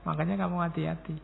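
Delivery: ordinary delivery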